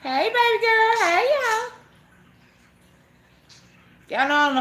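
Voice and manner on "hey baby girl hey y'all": much higher, louder, slow hello; sing-song greeting. Real, not acted. Welcoming a viewer into the live, high slow sing-song hello.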